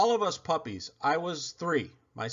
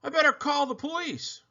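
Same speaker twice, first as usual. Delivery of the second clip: much higher urgent voice; urgent dog; 12 semitones higher than usual. Acted. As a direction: hurry to call for help. Frightened dog decides to act.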